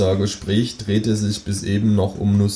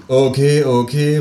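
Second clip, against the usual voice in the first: raised, slightly higher voice; calling out; 4 semitones higher, 5 dB louder, slower than usual. Acted. Narrator breaks the silence with the kangaroo, calling out. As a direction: cut in with a mild exclamation. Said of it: "rufe ich".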